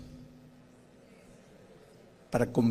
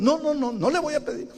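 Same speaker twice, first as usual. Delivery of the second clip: stubborn refusal. Retold or imitated. Preacher refusing the Spirit, high childish whine.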